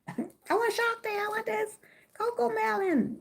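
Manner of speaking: put-on high child voice listing every toy; greedy kid pleading. Kid at the Easter egg hunt begging mommy, high whiny voice. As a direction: whine for each toy like a little kid.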